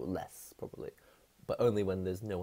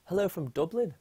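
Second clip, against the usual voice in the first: high, louder go at an Irish accent; attempted Irish accent; 10 semitones higher, 6 dB louder than usual. Shown as a demonstration. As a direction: try out a rough Irish accent. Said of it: "is that an Irish accent?".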